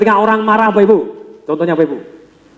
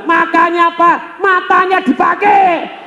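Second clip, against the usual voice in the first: high, slow cutting rebuke; mock teller scolding; scolding retort; 11 semitones higher, much slower than usual. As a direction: snap at the careless customer. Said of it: "teller ngomong gimana".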